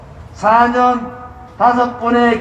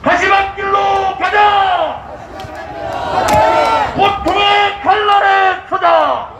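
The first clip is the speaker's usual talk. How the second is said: roaring rallying speech; 6 semitones higher than usual. Acted. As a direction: rouse the crowd like a rally leader. (군중을 선동하는 우렁찬 연설)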